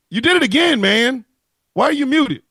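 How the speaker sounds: high, loud exasperation at the muted mic; exasperated call-out. Real, not acted. His co-host goes silent on mute again, so he calls out loudly and exasperated. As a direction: call him out for muting again.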